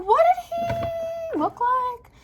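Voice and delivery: very high, stretched-out question; retold wary disbelief. Narrator asking her cousin about the dead boy, high stretched disbelief. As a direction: drag it out high, like dreading the answer.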